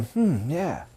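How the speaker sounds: quiet encouraging listener noises; agreeable murmur. Shown as a demonstration. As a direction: murmur agreement to keep them saying yes. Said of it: "so you start to learn".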